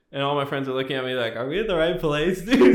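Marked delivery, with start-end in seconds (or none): 1.4-2.7 laughing, rising higher and louder to a squeal on dude; half-laughed, baffled question to a friend; laughing disbelief. Retold or imitated. Friends' confused reaction at conference.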